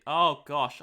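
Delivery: sudden very loud exclamation; real dismayed outburst. Real, not acted. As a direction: blurt it out loudly, caught off guard. Sudden dismay while drawing a border.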